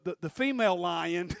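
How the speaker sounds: usual speaking voice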